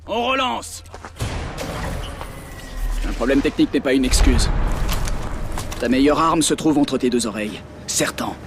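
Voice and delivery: loud scolding debrief; stern instructor. Acted. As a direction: dress down the trainee. Instructor scolding after failed sim.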